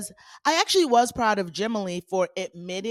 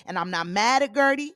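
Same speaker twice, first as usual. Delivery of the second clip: normal talking voice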